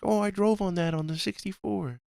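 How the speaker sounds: lightly voiced excited fan; mock fan voice. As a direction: play a gushing fan recognizing the level. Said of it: "people are gonna be like".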